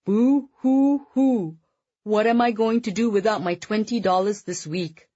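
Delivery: sarcastic. A narrator's reading. Son mocking dad over lost allowance, sarcastic mock weeping. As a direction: fake a wail to mock your father. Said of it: "Constantine said sarcastically".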